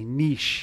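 niche as "neesh", long ee; imitated pronunciation. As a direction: mimic the would-be cool "neesh" for niche. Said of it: "trying to be cool when they say".

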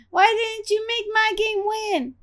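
high-pitched audience complaint; whiny protest from the cheap seats; peanut gallery heckle. Pretend. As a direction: heckle in a high mock-aggrieved voice. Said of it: "She's the peanut gallery saying".

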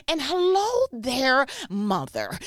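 mock preacher holler with gasped "ha". Imagined pastor greeting mother on the phone, preacher tone. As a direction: draw it out loud and high, then drop. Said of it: "If you don't talk like that".

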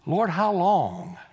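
slow, drawn-out plea; hammed-up pleading with God; mock reluctance. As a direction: stretch it out like a reluctant complaint. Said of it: "this is how I know he's Baptist".

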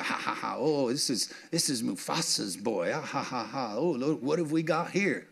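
soft, cackling hyena taunt with little ha-has; a hushed cackling jeer at the cub; mocking hyenas. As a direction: sneer at the lion cub like a hyena, kept hushed. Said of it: "And so the hyenas are".

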